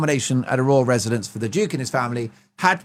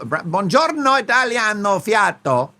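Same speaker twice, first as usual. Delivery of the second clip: mock Italian; 6 semitones higher, 3 dB louder than usual. Mock Italian band greeting.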